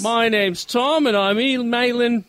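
badly faked British, landing Australian; British accent gone Australian. Reader voicing British Tom's letter, accent slipping Australian. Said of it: "Oh, I went Australian".

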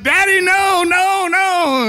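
high, loud mock wail; hammed-up panicked child's cry; scared child. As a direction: plead like a frightened little boy being chased. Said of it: "and he's scared".

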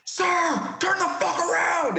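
high, full-force yell at the captain; shout. Retold or imitated. Soldier shouting at his captain mid firefight. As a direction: yell over the noise to turn him around.

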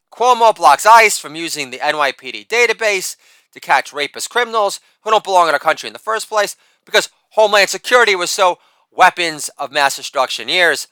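ordinary talk, nothing marked

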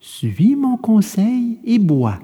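slow, firmly gentle fairy. Acted. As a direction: insist slowly and kindly. Fairy insists on the medicine. (voix de fée lente et ferme)

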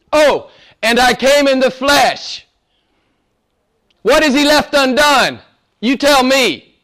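loud preaching that climbs high; forceful, challenging preacher's cry; full-force preaching. Acted. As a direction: thunder it at the congregation, rising in pitch.

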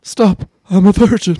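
mock whine. Mock whiny protest.

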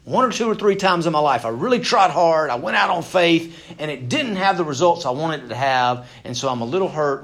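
high, loud plea of a burnt-out man; voicing a hurt minister. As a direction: pour out his wounded complaint. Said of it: "It's kinda like".

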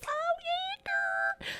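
very high, squeaky mock squeal. Host dreaming of being famous, with a squeaky squeal. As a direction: squeal it like a giddy fan girl.

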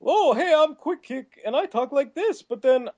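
put-on cartoon voice, starts very high, then drops; hammed-up accent-juggling stuntman; Quick Kick. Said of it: "he's always doing, like, accents".

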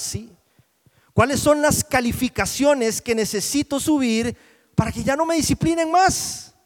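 loud, high, insistent questions; full-force preacher pleading for self-examination; impassioned preaching. Real, not acted. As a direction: press the questions on the congregation.

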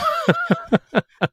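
shrieking high laugh; high burst of laughter. Real, not acted. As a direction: break into a squealing laugh. Laughs after imitated stare.

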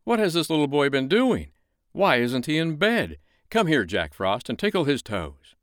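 a king firing off questions; Winter King. Acted. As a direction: Winter King speaking fast. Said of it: "he began to talk very fast".

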